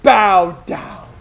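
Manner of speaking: pompous villain looming over his underling; villain director voice. Acted. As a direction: lord it over the small fry. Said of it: "I gotta get into character".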